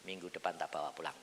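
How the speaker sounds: low, hushed divine instruction; suara Tuhan. Acted. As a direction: deliver it softly and calmly. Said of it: "Tuhan ngomong sama saya". (suara Tuhan yang pelan dan rendah)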